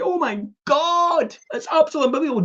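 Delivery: very high-pitched gushing disbelief; hammed-up winner's ecstasy; overjoyed. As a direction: stretch it out in squealing amazement. Acted lottery winner's joy.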